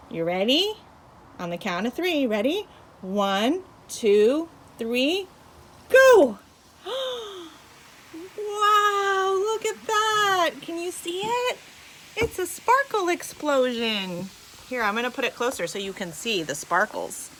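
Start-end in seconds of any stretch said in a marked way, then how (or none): 3.0-6.4 building to a loud shout; excited countdown. Real, not acted. Countdown to explosion. 6.8-7.5 sharp delighted gasp; excited gasp. Real, not acted. Explosion happens. 8.4-14.3 high, loud, excited wonder; amazed. Real, not acted. Reacting to explosion.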